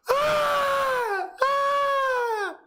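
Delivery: high screaming wail; hammed-up shriek of horror; mock scream. Mocking the wife who can't take the truth, hammed-up screams.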